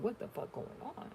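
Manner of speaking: breathy whispered high what-the-fuck; whispered disbelief. Retold or imitated. She wonders why drivers keep failing, whispered disbelief. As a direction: hush it like you can't believe it. Voicing her own confused thought.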